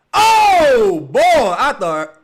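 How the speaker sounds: loud, high gasping cry at the play; real startled shout. Real, not acted. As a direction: jump at the near dunk. Reacting to near dunk.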